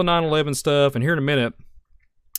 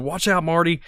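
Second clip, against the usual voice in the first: Doc's warning; 6 semitones higher than usual. Retold or imitated. Host imitating Doc Brown warning Marty, high urgent call. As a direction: yell a quick warning like Doc. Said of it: "He's like".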